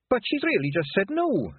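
high, blurted protest; burst out. Acted. Fionn blurting out to the other pixies, high and urgent. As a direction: blurt it out in alarm. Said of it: "Fionn burst out".

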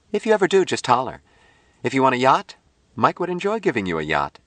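high-pitched, offhand woman's voice; breezy Jill character. A narrator's reading. Jill offers Patty a yacht, breezy and high. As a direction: toss out the offer in a high lilt.

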